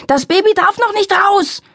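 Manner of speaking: high, loud panicked yell; bellowing mother. A narrator's reading. Mother in labour bellowing at her family, high and loud. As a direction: scream it out, the baby must not come yet. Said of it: "brüllte meine Mutter unentwegt".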